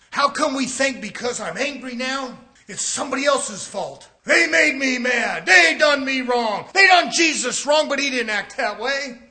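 loud, rising pulpit shout; preaching hard. Acted. Preacher accusing the church of acting, loud and rising.

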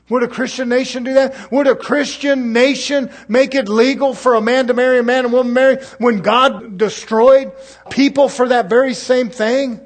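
preacher pressing the church with questions, fiery and rising; fiery preaching. Acted. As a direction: hammer each question home to the congregation. Rising preaching at full force.

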